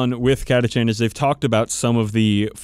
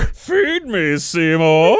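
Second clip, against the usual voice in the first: mock plant voice; 5 semitones higher, 3 dB louder, much slower than usual. Quoting the plant Audrey II.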